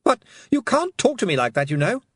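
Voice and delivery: put-on high, loud boyish protest; indignant Roland. A narrator's reading. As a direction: object like a spoilt young heir.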